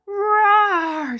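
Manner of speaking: high, drawn-out playful roar; jaguar roar. Pretend. Teacher playing a jaguar for children, high mock roar. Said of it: "says the jaguar".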